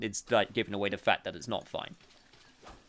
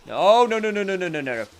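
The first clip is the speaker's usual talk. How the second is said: frantic no; 9 semitones higher, 8 dB louder than usual. Real, not acted. Panic during gameplay.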